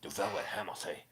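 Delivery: soft put-on Velvet Hammer accent; Bad Brad accent. Showing who Bad Brad sounds like, soft mock accent. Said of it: "That's who Bad Brad sounds like".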